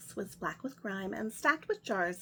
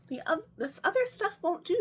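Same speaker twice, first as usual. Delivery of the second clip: higher, a touch louder witch voice; fussy witch; 9 semitones higher, 4 dB louder than usual. Acted. Witch pushing aside wrong ingredients, high and fussy. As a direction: toss it off like a picky witch.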